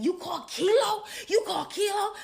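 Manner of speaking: high, loud alarmed question; imitated screaming. Storyteller mimics the friend screaming in the background, high shouted question. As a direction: yell it in disbelief, pitch jumping up. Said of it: "is screaming in the background saying".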